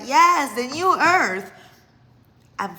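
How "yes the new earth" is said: high, loud burst of delighted recognition; excited exclamation. Retold or imitated. Telling viewers how she answered a friend, excited. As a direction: exclaim it loudly, pitch leaping up.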